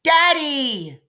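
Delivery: put-on high, loud little girl's shout of joy; delighted scream. Little girl sees her father's truck and screams with delight. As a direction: squeal for her daddy. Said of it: "Maybell screamed with delight".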